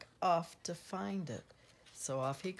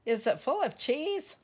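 loud, higher mock-doubting question; snorted question; 6 semitones higher, 6 dB louder, faster than usual. A narrator's reading. Squeak doubting Pip's find, snorted question. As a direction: ask it loud and high, lightly scornful. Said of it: "Squeak snorted".

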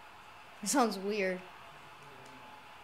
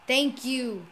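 ordinary talk

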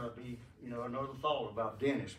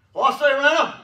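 very loud, high call; sudden big shouted hail; hollered greeting; 11 semitones higher, 19 dB louder than usual. Retold or imitated. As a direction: holler it to startle him. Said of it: "but I said".

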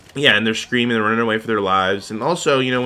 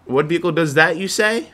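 hammed-up ad question; 6 semitones higher than usual. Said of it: "You're like".

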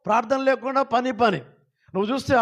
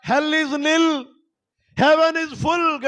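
higher, louder proclaimed slogan; 7 semitones higher, much slower than usual. Shown as a demonstration. Pastor leading the church in a chant, loud proclamation. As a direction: call it out for all to repeat. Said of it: "గట్టిగా చెప్పండి అందరూ కలిసి".

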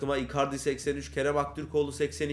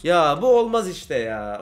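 slow, louder frustrated complaint; real annoyance, slow and loud; annoyed; 8 dB louder, slower than usual. Real, not acted. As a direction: drag it out, fed up. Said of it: "Şu anda sinirlendim".

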